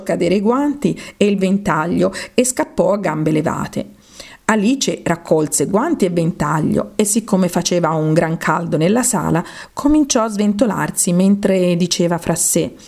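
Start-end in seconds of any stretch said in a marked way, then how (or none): none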